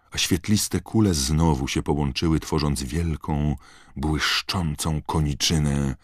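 plain reading voice

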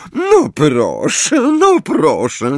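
high, slow chirping sweetness; twittering. A narrator's reading. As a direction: coo it like a smug songbird. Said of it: "zaszczebiotała profesor Umbridge".

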